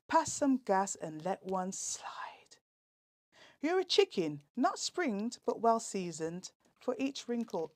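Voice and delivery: rhythmic, punchy performance-poetry delivery; comic poet performing verse with a lilt; poem recital. Acted. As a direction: perform the rhyming verse for the radio audience. Performance poem read on radio.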